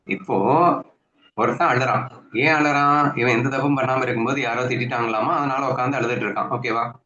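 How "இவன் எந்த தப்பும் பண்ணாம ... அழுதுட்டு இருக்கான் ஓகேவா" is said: ordinary delivery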